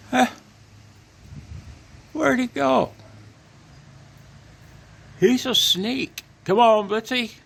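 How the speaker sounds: loud, high call across the yard; shouting for the dog. Real, not acted. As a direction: belt out the dog's name to bring him back. Owner calling his runaway dog.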